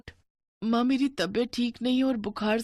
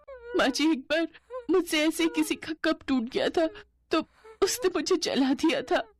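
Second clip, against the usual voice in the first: put-on much higher, trembling young bride recounting pain; tearful young bride; 10 semitones higher than usual. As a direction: confess the old hurt in a small high voice. Bride recounts past abuse.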